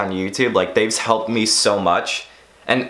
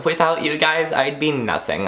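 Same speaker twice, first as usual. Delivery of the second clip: mocking YouTuber cliché; 6 semitones higher than usual. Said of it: "I hate the YouTubers that say that".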